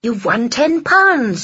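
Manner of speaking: high, slow, excited delivery; thrilled and drawn out; delighted announcement. Acted. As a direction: draw out the good news up high. Mary announces a lottery win.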